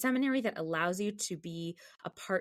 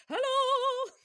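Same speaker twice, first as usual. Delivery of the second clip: much higher, louder booming sing-song hello; Herschel's hello; 6 dB louder than usual. Retold or imitated. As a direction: belt out a big theatrical greeting. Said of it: "He said".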